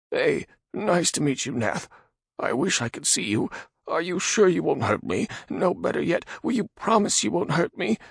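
high nervous man voice; frightened Radagon. Acted. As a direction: ask in timid fear. Radagon timid.

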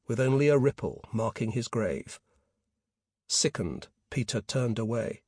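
ordinary delivery

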